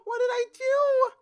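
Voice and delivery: very high, loud hammed-up wail; theatrical high-pitched wail of regret; mock despair. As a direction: cry it out like an overdone sob. Dramatic regret over game events.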